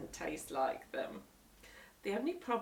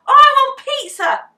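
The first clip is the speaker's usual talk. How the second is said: high, loud furious shriek; 24 dB louder than usual. A narrator's reading. Henry refusing his supper, shrieking loud. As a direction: scream it at the sandwich. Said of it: "shrieked Henry".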